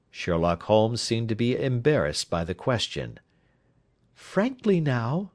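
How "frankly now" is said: high, slow lady's prompt; insistent wife. Acted. Wife standing over the detective, high slow insistent voice. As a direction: draw it out, pressing for candour. Wife repeats her demand.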